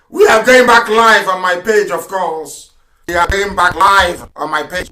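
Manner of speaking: loud, emphatic declarations; defiant preaching. Real, not acted. Broadcaster telling listeners the attackers are criminals, loud and defiant. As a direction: hammer it out at the opponents.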